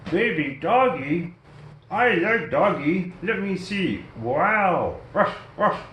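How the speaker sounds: high, toy dog voice; Snoopy voice. Acted. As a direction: play the plush dog, eager and high. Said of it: "Snoopy says".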